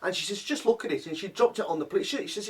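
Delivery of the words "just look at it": high mock disgust; mimicking mum. Mum sneering at cold scone, high mock disgust.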